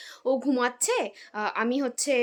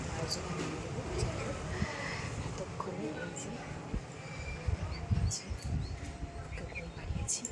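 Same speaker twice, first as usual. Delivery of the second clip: speaking softly; 5 semitones lower, 11 dB quieter than usual. Real, not acted. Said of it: "আমি আস্তে কেন কথা বলছি". (আস্তে, চাপা গলায় কথা বলা)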